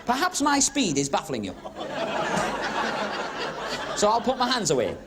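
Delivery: usual speaking voice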